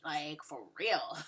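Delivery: breaking into a laugh. Real, not acted. Vlogger laughing at the pregnancy reveal. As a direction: crack up.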